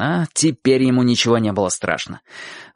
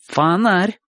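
higher, emphatic affirmation; confirming; 7 semitones higher than usual. A narrator's reading. Boy confirms the bump to Bocho, emphatically. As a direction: state it back as certain fact. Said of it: "подтверждает тот".

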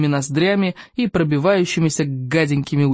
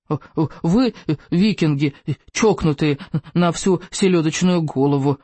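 usual speaking voice

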